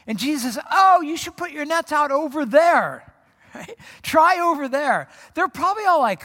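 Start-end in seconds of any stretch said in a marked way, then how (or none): 0.7-3.1 loud, high call across the water. Acted. Calling from shore to boats. 4.0-5.1 high, loud, stretched-out call. Acted. Calling from shore to boats.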